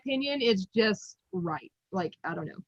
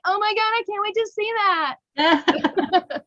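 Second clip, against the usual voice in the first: high-pitched fangirl gush; retold excitement; 9 semitones higher than usual. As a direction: act out giddy anticipation, pitched way up. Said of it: "to me, I was like".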